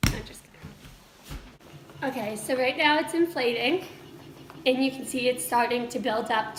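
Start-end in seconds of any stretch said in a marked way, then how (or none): 0.1-2.1 breathy whispered joke; whispered aside. Real, not acted. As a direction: drop to a whisper, teasing. Joking aside after fake start.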